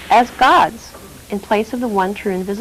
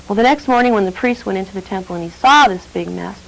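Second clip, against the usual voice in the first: plain reading voice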